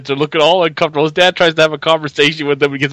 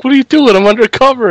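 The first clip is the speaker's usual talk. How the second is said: high, louder flustered objection; mock protest; 9 semitones higher, 5 dB louder than usual. Guest voicing Jonah when parents showed up, high mock protest. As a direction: protest in a raised pitch.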